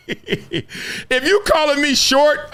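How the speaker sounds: slow, laughing through the words; chuckling while shrugging off an insult. Real, not acted. Vlogger laughing off people who call him short.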